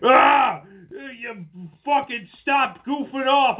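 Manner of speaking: hammed-up cranky old man; upset old man. Old men upset about touchdown celebrations, mock outrage. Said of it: "old men get really, like, upset".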